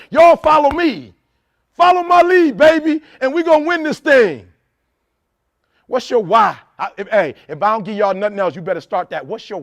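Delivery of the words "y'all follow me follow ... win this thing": imitating a leader calling his team to follow, high and loud; rallying cry. A hint of Ray Lewis rallying his teammates, loud. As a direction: belt it out like a captain leading the charge.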